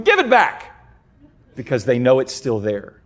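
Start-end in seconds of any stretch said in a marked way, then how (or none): 0.0-0.8 put-on demanding toddler. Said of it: "they go".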